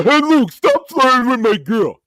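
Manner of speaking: loud, high stoner Sicilian guy; Bonesy voice. Retold or imitated. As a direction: bark it like a dopey stoner. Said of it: "That's how Bonesy talks".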